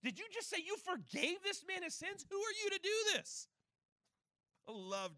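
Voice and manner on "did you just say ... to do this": acted outraged disbelief, high and loud; high, loud, indignant mock outburst; hissy fit. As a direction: play scandalized Pharisees losing their minds. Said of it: "threw an ever-loving hissy fit. They lost their minds".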